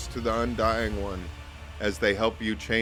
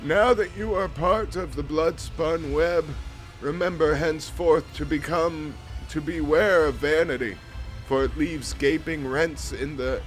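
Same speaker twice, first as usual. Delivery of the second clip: higher, grave priestly voice; solemn vizier; 5 semitones higher than usual. Acted. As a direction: speak as an ancient cult priest.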